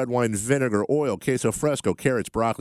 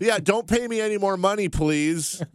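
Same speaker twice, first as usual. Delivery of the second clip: sarcastic; 9 semitones higher than usual. Acted. Sarcastic about low contract pay.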